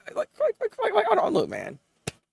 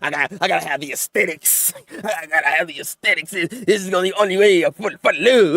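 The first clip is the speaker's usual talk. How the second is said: mocking voice; 5 semitones lower than usual. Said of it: "you out here being stupid".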